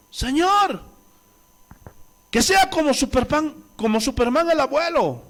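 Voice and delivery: high, hammed-up plea to God; mock prayer. Pastor imagines praying for an impossible healing, mock-fervent prayer. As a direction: raise the pitch as in an overblown prayer. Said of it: "Imagínense que yo le diga".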